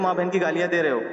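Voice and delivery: ordinary delivery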